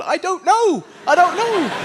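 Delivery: high and helpless, falling at the end; mock bafflement. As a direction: throw it up high, as if clueless. Answers his own tennis ball question.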